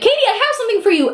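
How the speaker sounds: high, quick singled-out call; mock eager call-out. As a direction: call to the judge like an over-eager fan. Mimicking pestering judge from the stage.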